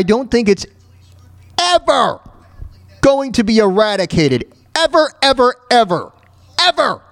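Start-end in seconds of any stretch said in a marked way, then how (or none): none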